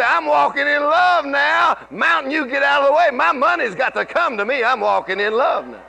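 bold declaration shout. Shown as a demonstration. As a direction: shout it out like a confession of faith. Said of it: "You say".

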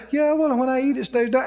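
imitating people who say it without believing; half-believing recital. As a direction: toss it off quickly, a little high. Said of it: "this is where people lose it".

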